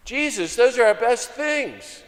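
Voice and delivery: high, loud comic objection; mock protest. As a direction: protest like a dismayed follower, drawing it out. Acting listeners protesting to Jesus.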